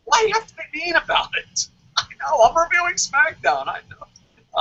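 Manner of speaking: high, fast, put-upon whine; mock whiny reply. Answering the rival reviewer in a mock whiny voice. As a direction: play the wounded victim. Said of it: "You're like".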